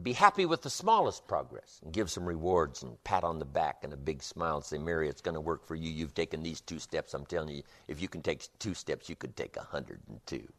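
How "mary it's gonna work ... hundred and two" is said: soft, encouraging pep talk. Acted. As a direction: gently reassure her she can do it. Encouraging a student named Mary.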